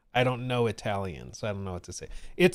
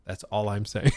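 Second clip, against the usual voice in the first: ordinary delivery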